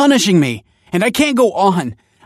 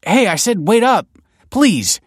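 high, urgent shout; loud, pleading call; 14 semitones higher, 9 dB louder than usual. A narrator's reading. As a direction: shout after someone hurrying away. Calling after the fleeing scholar.

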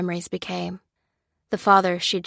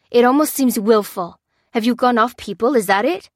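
higher, louder furious protest; fuming; 4 semitones higher, 7 dB louder than usual. A narrator's reading. Lucy fuming at Lopez over Jackson, loud furious protest. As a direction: seethe through each word.

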